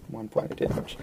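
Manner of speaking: unvoiced whispered echo. Shown as a demonstration. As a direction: whisper it back under your breath.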